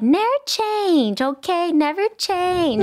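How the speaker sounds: put-on high, drawn-out little-girl voice; little girl impression. Quoting a viral video to her friend, imitated little girl. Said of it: "it's like a little girl speaking Spanglish".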